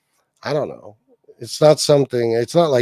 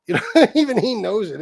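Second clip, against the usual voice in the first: repeated through a laugh, higher; 7 semitones higher, 4 dB louder than usual. Real, not acted. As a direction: chuckle while you say it again.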